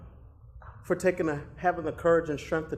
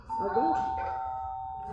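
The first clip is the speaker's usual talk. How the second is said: very slow, breathy whisper, softer; tender whisper; 5 semitones higher, 4 dB quieter, much slower than usual. Acted. [name] reassuring scared Damon, slow tender whisper. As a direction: whisper it like a promise. Comforting his scared fiancé.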